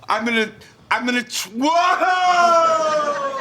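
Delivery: fast then high shouted whoa; excited shout. Acted. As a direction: burst with calculator joy. Sketch twins excited.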